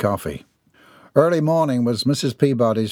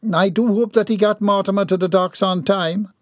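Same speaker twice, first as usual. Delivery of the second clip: higher, prim Southern lady; ladylike voice; 10 semitones higher than usual. Acted. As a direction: speak as a proper, composed matron.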